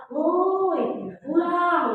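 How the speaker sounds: loud, high village call across the yard; high, loud shout to call the kids home. Shown as a demonstration. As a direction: holler for the kids to come home.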